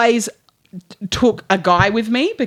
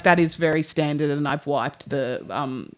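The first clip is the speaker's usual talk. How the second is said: soft mechanic voice; 7 semitones lower, 8 dB quieter than usual. Retold or imitated. Tire man voiced softly.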